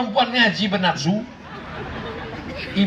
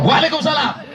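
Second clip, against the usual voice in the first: firm, higher-pitched phone answer; 7 semitones higher than usual. Shown as a demonstration. Mother answering a phone call from a man, firm. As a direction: return the greeting curtly and firmly. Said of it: "harus tegas".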